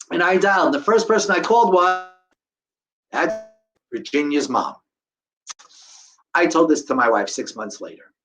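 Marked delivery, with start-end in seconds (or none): none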